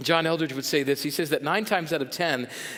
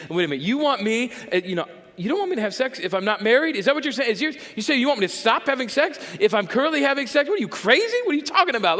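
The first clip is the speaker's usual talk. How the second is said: mock incredulous outrage; 5 semitones higher, faster than usual. Pastor voicing a churchgoer shocked at the advice, mock outrage. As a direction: play the scandalized listener who can't believe it.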